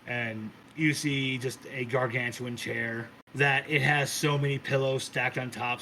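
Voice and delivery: ordinary delivery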